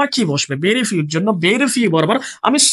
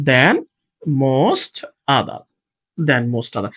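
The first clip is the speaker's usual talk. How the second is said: THAN, MOST, OTHER each set apart by pauses; low, measured "than", "most", "other" with gaps between; word-by-word dictation; 7 semitones lower, much slower than usual. Shown as a demonstration. Dictates words while placing them.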